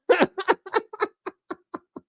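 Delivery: a burst of high scornful laughter; high mocking laugh. Real, not acted. Host laughing at women turning it against you, high mocking laugh.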